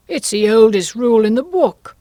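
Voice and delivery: loud, blustering king. A narrator's reading. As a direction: bluster back loudly. King retorts loudly.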